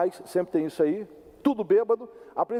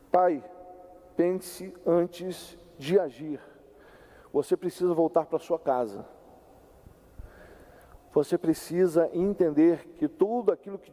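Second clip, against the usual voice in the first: apelo pausado; 4 semitones lower than usual. Real, not acted. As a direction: plead with fathers, weighing each word. Pregador apela direto aos pais. (apelo pausado, em tom mais baixo)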